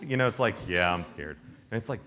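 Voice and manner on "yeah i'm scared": hulky guy. Retold or imitated. Hulkiest soldier answering whether he is scared, hulky guy voice. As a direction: play the hulk admitting fear, deep and subdued. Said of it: "like, hulky type".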